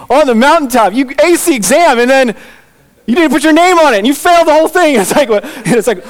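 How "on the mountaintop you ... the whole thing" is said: high, loud, building comic disbelief; mock exasperation. Disciples telling Peter he failed the exam, mock exasperated. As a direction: climb higher and louder, ribbing a friend.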